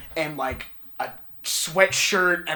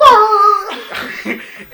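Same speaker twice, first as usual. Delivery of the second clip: very loud, high mock roar; monster roar; 13 dB louder than usual. Goliath suddenly coming over a petrified dog, mock roar. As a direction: roar like a giant bearing down.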